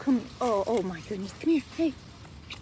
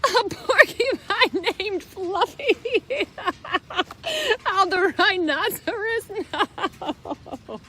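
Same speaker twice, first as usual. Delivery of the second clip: laughing rhino; 4 semitones higher than usual. Acted. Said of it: "roared with laughter".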